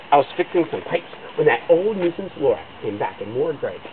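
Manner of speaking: plain reading voice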